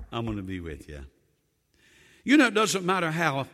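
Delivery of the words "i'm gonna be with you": low, gentle divine promise; soft deep reassurance; reassuring God. Acted. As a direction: comfort him quietly.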